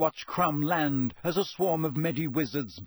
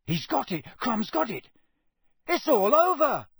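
excited shout building higher and louder; Harry shouting; 9 semitones higher, 4 dB louder than usual. A narrator's reading. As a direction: build to a triumphant yell. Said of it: "shouted Harry".

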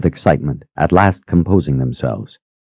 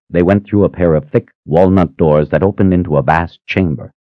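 ordinary delivery, nothing marked

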